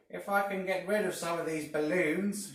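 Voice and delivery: high, loud cackling witch voice; a sly old hag plotting out loud; Mrs Twit. A narrator's reading. Mrs Twit speaks aloud, quote.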